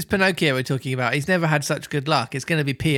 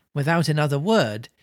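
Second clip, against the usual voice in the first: ordinary talk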